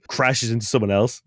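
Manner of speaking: ordinary talk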